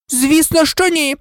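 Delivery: high-pitched affronted denial; indignant. A narrator's reading. Nurse answering whether pupils left their beds, indignant. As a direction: deny it as if insulted. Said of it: "обурено заперечила мадам Помфрі".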